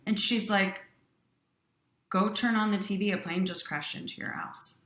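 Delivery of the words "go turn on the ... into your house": ordinary talk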